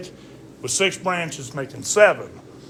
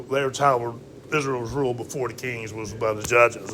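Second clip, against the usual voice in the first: usual speaking voice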